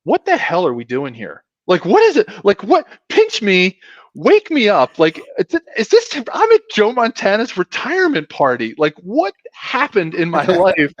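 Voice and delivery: high, excited retold astonishment; amazed disbelief. Retelling his awe at Joe Montana's party, high and excited. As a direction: relive the pinch-me moment, voice jumping up. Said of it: "I was like".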